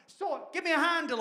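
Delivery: high, whiny complaining vase voice; high-pitched grumbling pot; talking vase. Retold or imitated. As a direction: play a fussy vase moaning at its potter. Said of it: "saying to the potter".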